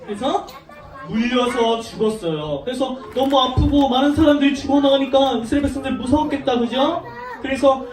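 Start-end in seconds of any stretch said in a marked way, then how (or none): none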